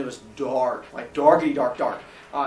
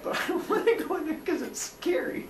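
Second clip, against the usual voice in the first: put-on high whiny frightened kid voice; scared kid admitting he won't crawl in, high whine; 5 dB quieter than usual. Scared kid voice, unspoken thought.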